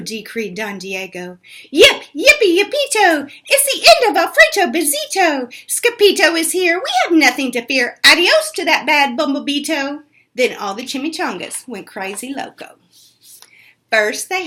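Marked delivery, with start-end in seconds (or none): 1.7-10.0 high, loud jubilant cheer; high loud excited chant. Acted. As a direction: cheer like the whole pack is saved. Chihuahuas cheer the hero.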